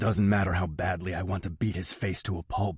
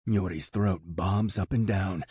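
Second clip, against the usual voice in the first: ordinary delivery, nothing marked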